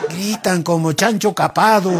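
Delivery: ordinary talk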